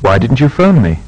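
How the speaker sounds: "Why didn't you come last week?" rising steadily, short fall on "week"; a frank, polite question that climbs and dips at the end; "Why didn't you come last week?" with a continuous rise and a final fall; rising tune, short final fall. Shown as a demonstration. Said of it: "Make similar questions using why or how".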